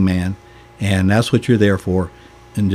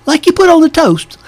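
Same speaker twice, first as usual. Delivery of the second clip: very high, loud mock English bishop; butchered English accent; 6 dB louder than usual. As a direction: puzzle it out in a hammed-up English accent. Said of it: "I'm gonna butcher the accent".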